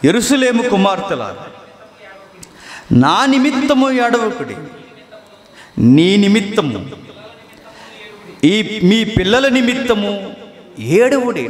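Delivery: high, slow, pleading voice of Jesus; Jesus gently pleading with the weeping women, slow and high; voicing Jesus. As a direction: lift the voice and plead slowly as Jesus. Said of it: "యేసుక్రీస్తు వారు వారి వైపుకి తిరిగి".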